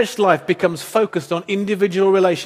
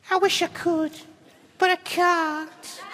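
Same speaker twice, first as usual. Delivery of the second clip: high, soft, mournful puppet voice; little bird's squeak, trailing into a slow refusal; Orville; 10 semitones higher, 6 dB quieter, slower than usual. Acted. Believer with nothing to give as puppet bird, high soft lament. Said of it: "Orville syndrome".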